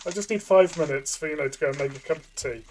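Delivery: ordinary talk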